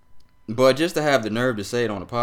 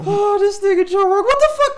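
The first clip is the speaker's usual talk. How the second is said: shrieked, much higher and louder; outraged disbelief; 11 dB louder than usual. Real, not acted. Host exploding at Rogan's remarks, shrieking disbelief. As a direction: blow up in disbelief.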